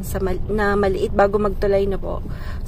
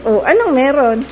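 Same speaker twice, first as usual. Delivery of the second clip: loud calling out; 3 semitones higher, 5 dB louder than usual. Real, not acted. Asking people outside what is happening, loud call. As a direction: shout the question across the yard.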